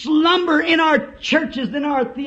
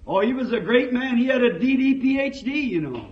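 normal talking voice

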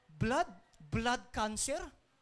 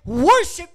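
"worship" drawn out, high and loud; stress on worship; 6 semitones higher, 15 dB louder than usual. Real, not acted. Preacher quoting God's demand, "worship" stretched and shouted. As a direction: swell hard on the first syllable of "worship". Emphasizing the word in a quoted verse.

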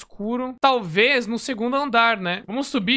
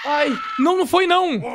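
high, louder panicked denial; panicked yell; 8 semitones higher, 4 dB louder than usual. Real, not acted. Nightmare turns out real.

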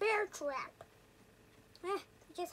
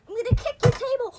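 shouting; 4 semitones higher, 9 dB louder, faster than usual. Real, not acted. Yelling at neighbor in game.

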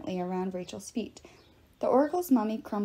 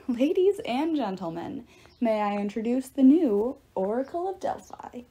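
grand announcer; 3 semitones higher, 5 dB louder than usual. A narrator's reading. Apollo introduces the new Oracle of Delphi, grand announcer. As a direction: present her to the crowd with a flourish.

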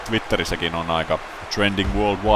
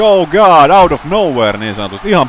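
loud, high burst of surprise; excited play-by-play; 9 semitones higher, 8 dB louder than usual. Real, not acted. Commentator shocked by a surprise superkick, excited yell. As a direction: yell it as the kick comes from nowhere.

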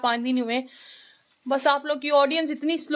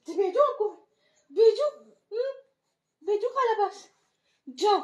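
sing-song to the little one; 6 semitones higher than usual. Real, not acted. Coaxing baby to go to auntie.

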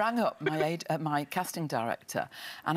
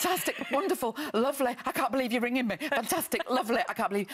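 high-pitched babble of delight, retold; starstruck gushing; 9 semitones higher than usual. Gushing to Steven Spielberg on the phone, starstruck and high. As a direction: gush helplessly, voice way up, acting it out.

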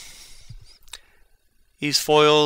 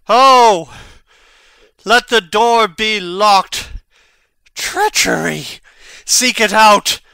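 loud, higher urgent orders; commanding cry; 5 semitones higher, 5 dB louder than usual. Acted. Hamlet to the court after his mother falls, urgent shouted commands. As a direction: rouse the court to find the traitor.

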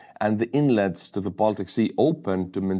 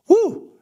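high, loud "woo"; celebratory whoop; 7 dB louder than usual. Real, not acted. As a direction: let out a loud, high cheer. Cheering Sweden joining NATO.

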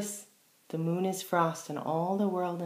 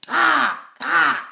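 loud, high crow cawing; 9 semitones higher, 10 dB louder than usual. Shown as a demonstration. Teacher showing children the crow's loud high caw. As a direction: call out like a crow. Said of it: "you're going to need two spoons like this".

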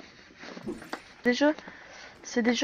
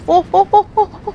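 high panicked oh's; yelling in rising panic; panicking gamer; 8 semitones higher, 12 dB louder, faster than usual. Real, not acted. Gamer panics mid-game.

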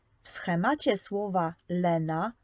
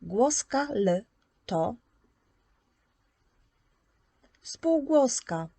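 slow, deliberate, with spółgłoska pitched higher; teacher emphasis; 3 semitones higher than usual. Shown as a demonstration. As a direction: spell out the rule slowly to a child. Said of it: "teacher stating rule slowly". (powoli i z naciskiem na spółgłoska)

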